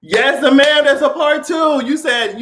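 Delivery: usual speaking voice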